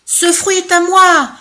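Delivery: loud, high bawl; bawling claim. Acted. Second elephant bawling his claim to the fruit, loud. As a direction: bellow it out greedily. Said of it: "brailla le deuxième éléphant".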